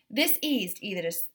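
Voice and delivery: ordinary delivery, nothing marked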